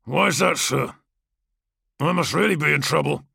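swaggering gladiator, barely hiding scorn; lightly voiced brash warrior. Kargos mocking his superior.

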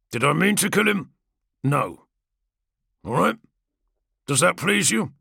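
swaggering gladiator, barely hiding scorn; lightly voiced brash warrior. Kargos speaks, voiced higher.

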